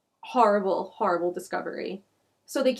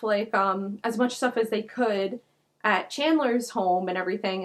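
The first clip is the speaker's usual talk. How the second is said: usual speaking voice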